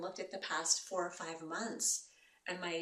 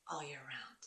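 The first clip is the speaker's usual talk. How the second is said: whispered aside; 5 semitones lower, 8 dB quieter than usual. Real, not acted. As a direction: drop to a hushed whisper. Soft aside about nature.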